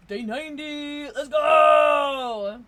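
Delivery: triumphant shout, cheering the streak; excited shout. Real, not acted. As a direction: yell it like you just won. Celebrating ninety-day streak.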